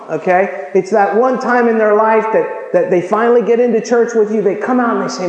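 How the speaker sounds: ordinary talk, nothing marked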